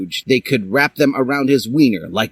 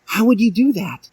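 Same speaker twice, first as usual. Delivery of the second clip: put-on much higher girl voice; Dally; 12 semitones higher than usual. A narrator's reading. Dally asking Hiei, much higher voice. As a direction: ask coyly. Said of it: "asked Dally".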